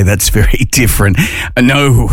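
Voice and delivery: normal talking voice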